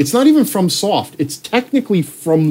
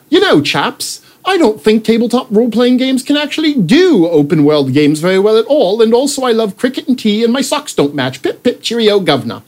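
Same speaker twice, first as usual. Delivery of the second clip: hammed-up posh English gent, higher; plummy mock British accent, pitched up; 6 semitones higher than usual. Friend Mendel mocked in a posh British accent. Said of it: "that's how he talks".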